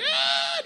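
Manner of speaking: imitating a baby's piercing high scream. Dad imitates his baby daughter screaming at him, piercing high shriek. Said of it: "She'd be like".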